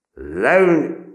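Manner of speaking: high, loud barked command; loud drill command; harsh shouted order. A narrator's reading. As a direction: bark it again at the boy. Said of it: "sergeant repeats order".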